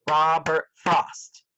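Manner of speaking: slow, deliberate ROB-ERT FROST, each beat distinct; syllable by syllable. Shown as a demonstration. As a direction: sound out "Robert Frost" slowly for counting. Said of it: "clapping my hands along with each different syllable".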